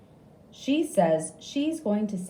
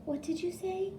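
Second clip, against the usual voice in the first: high, soft puzzled question; lightly voiced nurse asking; 12 semitones higher, 7 dB quieter than usual. A narrator's reading. Nurse asking patient to repeat, high and soft. Nurse didn't hear him.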